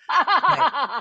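high, bright laugh into a word. Real, not acted. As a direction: laugh through it. Laughing at own joke.

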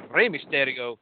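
Mexican-accented wrestler's name. Shown as a demonstration. Host announcing the match line-up, name in a Mexican accent. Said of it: "putting on a Mexican accent".

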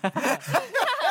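big high laugh on cue; forced laugh. Real, not acted. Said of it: "Can we get some more laughs?".

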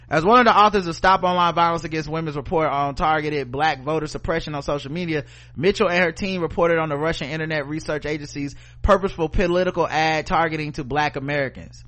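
ordinary talk

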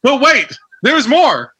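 infomercial pitch. Acted. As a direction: sell it like a TV pitchman. Quoting infomercial catchphrase.

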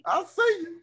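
high, playful hype; teasing. Real, not acted. Teasing compliment, pitched way up.

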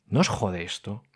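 ordinary delivery

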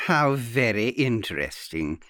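smooth. A narrator's reading. Aunt replying to earnest guest, smooth. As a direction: answer with silky politeness. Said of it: "came the smooth answer".

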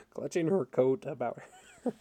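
corrects the slip through real laughter; laughing. Real, not acted. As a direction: read it while cracking up, stressing coat. Corrects misread word while laughing.

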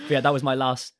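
ordinary talk, nothing marked

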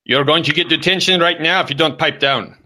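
high-pitched scolding; put-on dad at the end of his patience; mock stern father. Father warning Junior of detention, mock stern.

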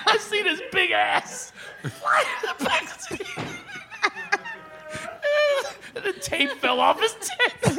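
high-pitched, helpless laughing delivery; can barely tell it for laughing; cracking up. Real, not acted. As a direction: squeal it out through laughter. Cracking up at own story.

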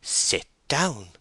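sharp insistence with a high-pitched fall; insistent summary command. Acted. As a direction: repeat the order, brooking no question. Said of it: "saw Bathsheba summarily speaking".

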